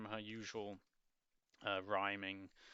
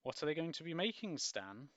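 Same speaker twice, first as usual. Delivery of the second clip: curious sister; 8 semitones higher, 5 dB louder than usual. Acted.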